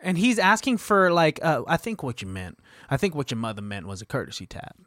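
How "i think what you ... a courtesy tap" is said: much lower and softer, quietly menacing; lightly voiced unhinged stalker, low and hushed. Russell Crowe's character demanding an apology, low menacing hush.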